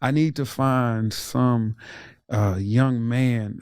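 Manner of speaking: ordinary talk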